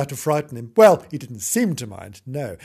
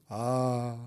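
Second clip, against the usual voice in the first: ordinary delivery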